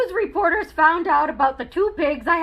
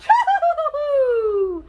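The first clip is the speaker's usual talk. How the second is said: huge sneeze; 10 semitones higher than usual. A narrator's reading. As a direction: let rip a giant sneeze. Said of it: "I sneezed once again".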